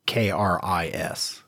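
ordinary delivery

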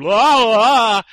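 loud, high mock wail; imitated wailing. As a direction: imitate a character's big close-up scream. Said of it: "big close-up of his face going".

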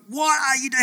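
high, scolding self-rebuke; scolding himself. Retold or imitated. Dreamer scolding himself at the church door, high rebuke. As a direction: snap at yourself in disbelief. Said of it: "I'm like".

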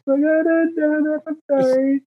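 high, whimpering mock apology; hammed-up tearful pleading. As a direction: plead helplessly, as if about to cry. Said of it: "I'm crying now".

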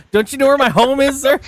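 mock indignation with a laugh; laughing comeback. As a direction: tease an official through laughter. Joking comeback amid laughter.

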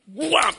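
a sudden loud high wham for the impact. Retold or imitated. Telling the church how the car hit him, a loud high wham. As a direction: punch out the crash sound. Car hits preacher's calf.

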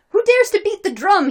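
high, loud indignant shout; shouted challenge. A narrator's reading. Drummer challenging whoever beats his drum, shouted. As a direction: shout it as an outraged demand. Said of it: "he shouted".

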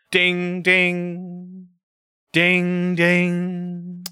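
high sung ding ding; bell imitation. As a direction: chime it like a ship bell.

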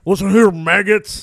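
loud barking order; a hint of drill sergeant. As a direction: bark like a boot camp instructor. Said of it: "snapping boot camp".

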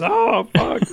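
high mock despairing groan; dramatic despair. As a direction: whine it out like a hammy victim. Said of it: "lays on the ground and goes".

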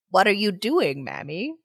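put-on faint, creaky old man voice. A narrator's reading. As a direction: speak as a frail old man. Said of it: "with the voice of a very old man".

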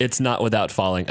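normal talking voice

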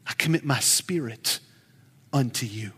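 soft, low dying prayer; quiet prayer. Retold or imitated. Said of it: "he prayed".

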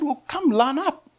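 put-on high, teasing offer to children; excited invitation to small kids; inviting kids. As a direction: pitch it right up for little ones.

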